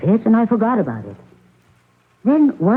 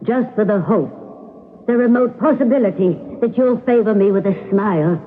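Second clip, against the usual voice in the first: theatrical stage delivery of a love scene, pitched higher; audition scene; 5 semitones higher than usual. Acted. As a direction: play it big for the audition, lingering on the pauses. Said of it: "That was marvelous, as usual".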